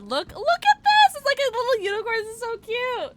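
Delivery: high, loud squeal of delight; excited gushing. Real, not acted. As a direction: coo over something adorable. Gushing over cute unicorn.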